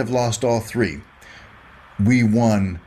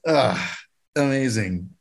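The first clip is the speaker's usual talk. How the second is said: ordinary delivery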